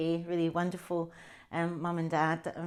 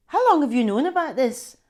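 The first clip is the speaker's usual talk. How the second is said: louder, higher angry demand at the brother; furious; 7 semitones higher, 10 dB louder than usual. Acted. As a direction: confront him, furious at his laughing. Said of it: "furious with him".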